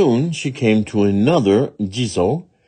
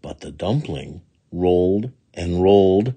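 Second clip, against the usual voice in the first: ordinary delivery, nothing marked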